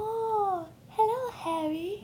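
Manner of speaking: high, slow, whiny moan; Moaning Myrtle. Acted. Co-host asked for impression, high moaning ghost voice. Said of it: "Could you do an impression on Moaning Myrtle?".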